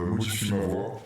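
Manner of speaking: ordinary delivery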